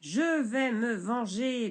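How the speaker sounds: grondé lentement, plus fort; grondement. Acted. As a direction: menace sourde, lentement. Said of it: "grognait Gonde le bûcheron". (le bûcheron gronde sa vengeance)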